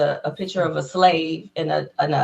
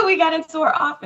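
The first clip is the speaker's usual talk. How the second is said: giddy laugh; 10 semitones higher, faster than usual. Real, not acted. Giddy retelling of luck.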